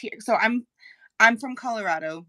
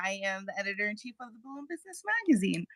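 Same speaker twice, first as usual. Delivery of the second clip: rapid-fire self-introduction; too fast; 7 dB quieter, much faster than usual. Real, not acted. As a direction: rattle off the job title in one quick run. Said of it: "you spoke really too fast".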